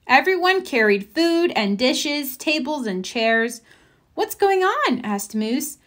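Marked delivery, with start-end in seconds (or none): 4.1-5.0 puzzled. A narrator's reading. Moose asks puzzled.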